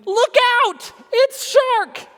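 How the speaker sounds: high loud fake alarm cry; terrible cry. A narrator's reading. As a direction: yell the warning like a prankster. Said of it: "came a terrible cry".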